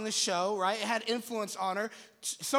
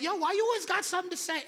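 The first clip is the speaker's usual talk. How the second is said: exasperated friend; 7 semitones higher, faster than usual. Retold or imitated. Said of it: "some people are always like".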